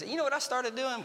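ordinary talk

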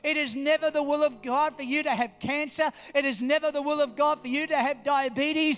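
loud, emphatic preaching. Real, not acted. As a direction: drive each declaration home to the congregation. Preaches at full force.

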